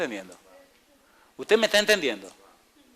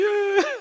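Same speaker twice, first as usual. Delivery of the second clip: laugh; 4 dB louder than usual. Real, not acted. Laughing at the story.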